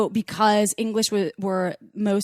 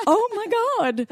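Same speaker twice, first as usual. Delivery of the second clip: retold shocked reaction; 13 semitones higher than usual. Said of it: "And I was like".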